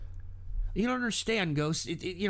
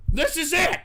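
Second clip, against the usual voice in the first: loud; 7 semitones higher, 6 dB louder than usual. Real, not acted. Ranting about society.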